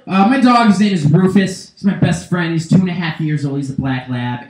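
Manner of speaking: ordinary delivery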